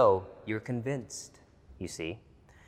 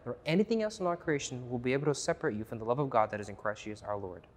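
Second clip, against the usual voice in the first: usual speaking voice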